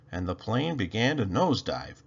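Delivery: usual speaking voice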